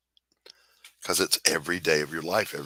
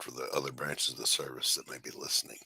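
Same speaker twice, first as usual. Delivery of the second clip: whispered aside; 5 semitones lower, 10 dB quieter than usual. Real, not acted. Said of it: "for the record".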